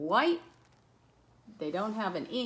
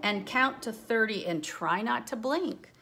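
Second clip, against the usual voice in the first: louder, lilting read-aloud of rhyming verse; reading rhyme aloud; 3 semitones higher, 4 dB louder than usual. Acted. Teacher reading a rhyming color book to the class, sing-song. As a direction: bounce through the rhyme like a storybook.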